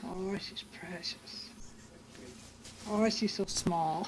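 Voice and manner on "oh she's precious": slow doting coo. Real, not acted. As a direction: coo softly over a newborn. Grandmother coos over newborn.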